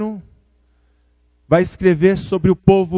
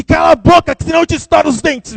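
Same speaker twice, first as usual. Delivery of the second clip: much higher, louder furious threat; mother yelling back; 12 semitones higher, 7 dB louder, faster than usual. Retold or imitated. The mother yells a threat back at her son. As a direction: snap back hard at the child.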